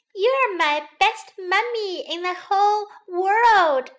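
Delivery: put-on high, slow, adoring child voice; small bunny full of love; little bunny. A narrator's reading. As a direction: gush to mummy in a high voice.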